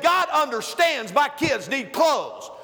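insistent wife quoted. Retold or imitated.

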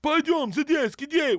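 high, mock Russian bark; barked Russian command. Cop to the American student across the platform, imitated bark. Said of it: "he barks out from across the platform".

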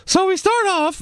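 very high squeaky start; mock lost voice. Host starting recap, teasing co-host with squeaky voice. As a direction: squeak as if catching the voice problem. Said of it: "I think it's contagious".

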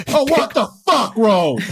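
hammed-up angry father; mock outraged dad. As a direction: blow up at the toddler for a joke. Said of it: "I certainly wouldn't be like".

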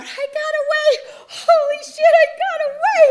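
high, loud, elated screaming; re-enacted hysterical triumph; freaking out. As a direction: shriek with wild relief at escaping. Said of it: "I'm freaking out".

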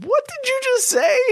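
very high-pitched mock shock; incredulous bystanders. Startled bus passengers turn on the joker, retold in high incredulous voice. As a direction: squeak the question like startled onlookers.

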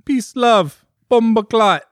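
very high, hammed-up Caribbean accent. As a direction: proclaim it in mock Caribbean. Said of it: "the Caribbean".

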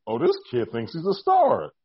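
slowly razz the cocky newcomer; mock taunting. Superstars making fun of the rookie, slow mock taunt. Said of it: "taunting me and making fun of me".

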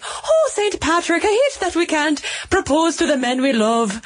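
high Irish lilt, a playful Saint Bridget; a hammed-up Irish Saint Bridget pleading, pitched well up; mock Irish lament, high and lilting; Irish accent. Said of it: "There you go, Molly. That was for you".

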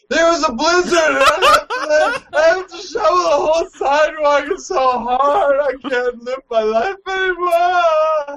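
hammed-up wailing. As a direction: wail it like a tragedy. Acted melodramatic whiny complaint.

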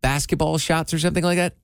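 usual speaking voice

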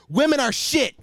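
loud, high alpha-male rant; Andrew Tate impression. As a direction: bark it like a macho podcaster. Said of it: "that's just my Andrew Tate impression".

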